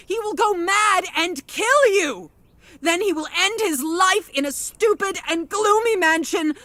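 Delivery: high, mounting distressed rant; agitated outburst. Acted. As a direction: build to an upset, high-pitched protest. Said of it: "Calm down".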